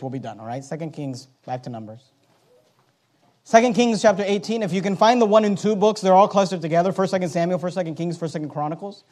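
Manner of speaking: ordinary talk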